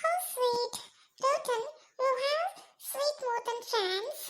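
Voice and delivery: high, very slow, soft voice; touched onlooker. Acted. Onlooker moved by Rottan's friends, soft and drawn out. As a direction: draw the words out softly and sweetly.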